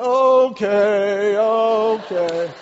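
loud, drawn-out okay; mock giving in. Husband caving to his rule-breaking wife, loud drawn-out surrender. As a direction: give in loudly, stretching each okay. Gives in to wife's dare.

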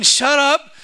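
high, loud string of mock prohibitions; scolding naysayers. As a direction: imitate disapproving people, hammering out each rule. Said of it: "they're the ones that are saying".

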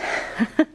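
short breathy nervous laugh; a quick anxious giggle. Real, not acted. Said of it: "Sorry, I'm nervous".

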